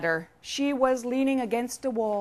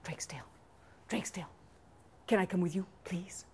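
very soft, whispered pleading; whispering fox; 9 dB quieter, faster than usual. Acted. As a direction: whisper it as a fox begging to come. Fox begs to come along.

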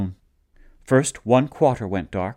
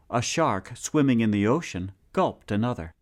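plain reading voice

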